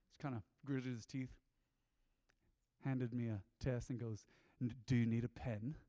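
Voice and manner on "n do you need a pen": irritated proper teacher. Retold or imitated. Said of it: "He was irritated".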